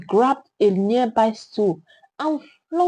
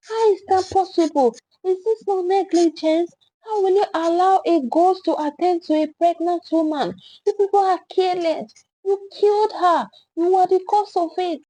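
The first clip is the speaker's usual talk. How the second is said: angry accusation; 7 semitones higher than usual. A narrator's reading. Crowd accusing staff angrily.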